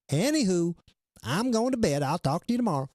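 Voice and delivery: high cutesy cartoon imitation. Host imitating crypto girl's last chat sign-off, cute cartoon voice. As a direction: chirp it like a cute little cartoon. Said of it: "in her cute little cartoon voice".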